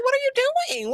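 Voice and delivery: high, squeaky imitation of Michelle confronting him; high mock demand. Friend voicing what Michelle should say, high mock demand. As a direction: pitch way up and demand it. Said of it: "They're like".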